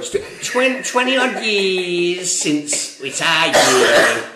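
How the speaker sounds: high, slow, loud mock Scouse; Scouse impression. Finishing his football-fan impression, hammed-up Scouse accent. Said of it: "I'm doing my fucking impression".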